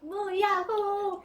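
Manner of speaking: slow, drawn-out silly cheer; meme cheer. Pretend. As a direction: stretch it out like a comic yell.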